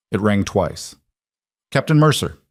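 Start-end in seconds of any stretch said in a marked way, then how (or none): 1.7-2.4 crisp, controlled officer's greeting, pitched up. A narrator's reading. Colonel answers her former officer's call, crisp and controlled. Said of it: "crisp, controlled".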